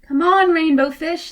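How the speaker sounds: loud, high cheerful call. Acted. Fish inviting the rainbow fish to play, loud call. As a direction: shout across the water to a friend. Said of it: "they called".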